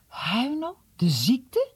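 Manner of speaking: high, hushed stunned echo; boy learning his friend is ill, horrified; horrified disbelief. Acted. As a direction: repeat the news in horror. Horrified repeat of news.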